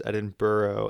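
Edinburgh ending in "burrow"; variant pronunciation. Shown as a demonstration. Listing variants for listeners, Edinburgh said as "Edin-burrow". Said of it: "Edinburgh is a great example".

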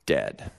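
low, dropped word dead; low flat dead. Shown as a demonstration. Preacher describing the dead mouse, low and final. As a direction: let dead fall flat. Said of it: "dead mouse".

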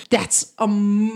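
usual speaking voice

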